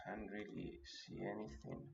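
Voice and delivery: breathy whisper to himself. Real, not acted. Trader whispering at his chart while searching for an entry, whispered. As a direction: drop to a whisper, hunting for an entry.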